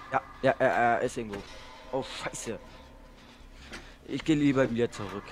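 ordinary delivery

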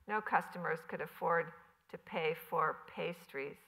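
ordinary delivery, nothing marked

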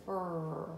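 soft "Fur" blend sound. Shown as a demonstration. Teacher isolating the "Fur" blend for the child. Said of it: "a F R blend".

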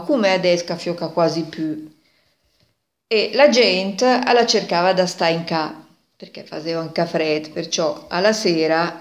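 ordinary delivery

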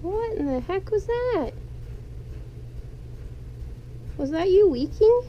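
high, startled and amused; surprised. Real, not acted. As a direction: caught off guard by a weird noise. Pet made strange noise.

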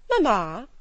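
Lydia calls out loud to her mother; a loud, eager call of Mamma; cried out. A narrator's reading. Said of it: "cried Lydia".

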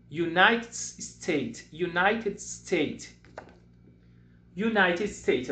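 very slow, clear United States; teacher drilling a country name; slow model word. Shown as a demonstration. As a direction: drill it slowly for repeating. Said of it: "O primeiro country".

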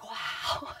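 breathy hushed wow; a soft whispered wow; awed whisper. Retold or imitated. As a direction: whisper it in awe. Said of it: "I was like".

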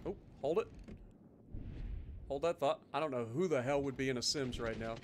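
ordinary delivery

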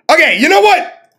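high, loud snap; about to wild out; outburst. Real, not acted. Almost wilds out.

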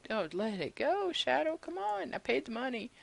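high, coaxing plea to a pet; pleading with the dog. Real, not acted.

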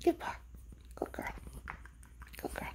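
soft whisper to the dog; whispered commands. Real, not acted. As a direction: hush it down to a breathy whisper.

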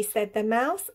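usual speaking voice

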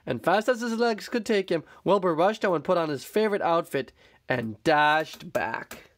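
ordinary delivery

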